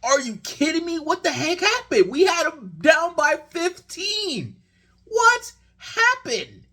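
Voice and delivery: disbelief. Retold or imitated. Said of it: "And I'm like".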